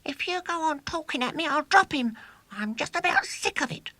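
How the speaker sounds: put-on high, fed-up boy voice; goaded Ginger. A narrator's reading. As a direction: snap back, goaded and fed up. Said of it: "The goaded Ginger's voice".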